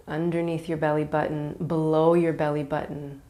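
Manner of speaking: calm, unhurried yoga nidra guidance with long pauses; guided meditation. Real, not acted. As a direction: ease them into relaxation, letting each phrase settle.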